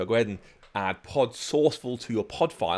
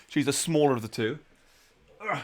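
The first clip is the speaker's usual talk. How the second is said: normal talking voice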